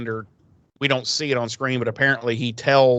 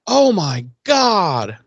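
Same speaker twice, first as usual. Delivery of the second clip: slow, higher, awed "Oh my God"; stunned; 6 semitones higher, 3 dB louder, much slower than usual. Retold or imitated. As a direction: let it drawl out in disbelief. Doctor sees many heartbeats.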